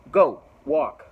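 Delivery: loud, forceful shout to leave; shouted order. Retold or imitated. Mike yells at the petition guy to leave, loud shout. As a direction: yell him away.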